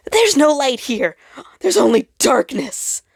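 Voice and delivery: high, loud broken sob of despair; sobbing. Acted. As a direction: cry it out, voice cracking up high. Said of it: "The star had sobbed to himself".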